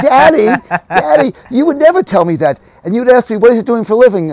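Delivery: high, loud laughing protest of a daughter; teasing daughter. Retold or imitated. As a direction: tease Dad with a laugh in the voice. Daughter voiced teasing her father.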